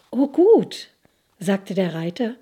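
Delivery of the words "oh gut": higher, louder little cry of delight; pleased exclamation. Acted. Young rider pleased to hear the way, high glad exclamation. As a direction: sound glad and a bit surprised.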